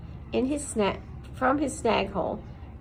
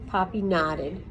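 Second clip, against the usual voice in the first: usual speaking voice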